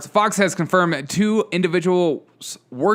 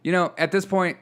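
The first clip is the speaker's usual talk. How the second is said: ordinary talk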